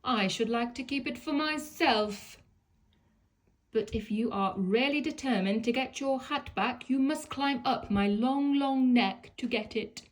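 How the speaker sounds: a lofty giraffe teasing from above. Acted. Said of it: "she said".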